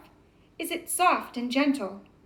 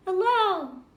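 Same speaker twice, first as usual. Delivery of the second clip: the same hello coming back from far off; distant echo; 7 semitones higher, 4 dB louder than usual. Retold or imitated. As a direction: sound like your own voice returning from across the mountains. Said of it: "only far away".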